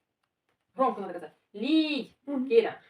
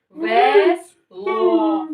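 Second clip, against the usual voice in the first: loud demo; 3 dB louder than usual. Shown as a demonstration. Teacher models the word once more, loud and drawn out. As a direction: stretch each syllable out loudly. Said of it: "Громко".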